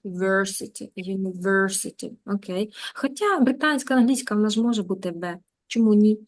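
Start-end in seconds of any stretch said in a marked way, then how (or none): 0.0-2.1 "university" with a stressed English "ver"; teacher isolates "versity", then says "university"; demonstrated "ver" syllable. Shown as a demonstration. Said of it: "вер вимовляється тут".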